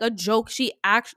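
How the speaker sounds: usual speaking voice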